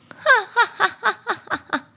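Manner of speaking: high wicked witch cackle; evil cackle. Acted. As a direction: cackle in triumph. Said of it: "王后高兴地狂笑着".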